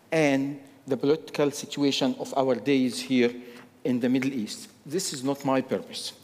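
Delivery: usual speaking voice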